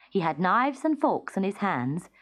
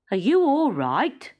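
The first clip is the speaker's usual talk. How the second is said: concerned policeman; 5 semitones higher, 5 dB louder than usual. A narrator's reading. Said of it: "he asked".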